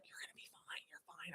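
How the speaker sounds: faint unvoiced reassurance; whisper. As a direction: whisper it like a doctor mouthing comfort. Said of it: "is looking at me like".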